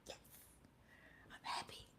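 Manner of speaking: breathy whispered confession; barely voiced, keep it secret. Real, not acted. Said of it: "whispered confession".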